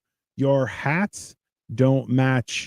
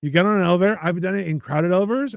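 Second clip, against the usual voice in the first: ordinary talk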